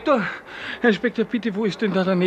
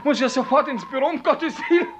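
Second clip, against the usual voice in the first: panicked; 6 semitones higher than usual. Acted. Clerk panics about being late.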